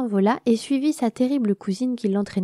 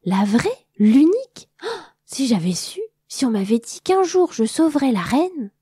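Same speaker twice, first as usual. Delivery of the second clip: thrilled; 6 semitones higher, 6 dB louder than usual. Acted. Butterfly thrilled to meet the queen.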